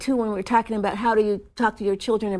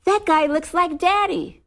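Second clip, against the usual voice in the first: higher, lightly voiced little boy; young son; 7 semitones higher than usual. Five-year-old son tells mommy on the plane, lightly voiced child. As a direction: lightly imitate a child pointing someone out. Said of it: "he says".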